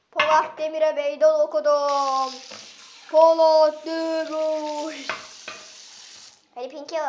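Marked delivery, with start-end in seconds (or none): 0.1-5.1 loud, drawn-out victory call; slow triumphant shout. Acted. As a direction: bellow it out slowly. Shouting after cold water.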